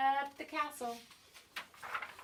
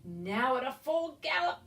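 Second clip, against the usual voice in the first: ordinary delivery, nothing marked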